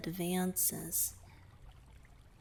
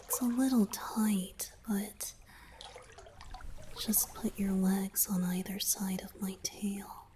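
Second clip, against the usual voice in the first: whisper. Acted. Merman confiding to his savior in a whisper. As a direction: whisper it like a confession. Guides listener into tub, whispering.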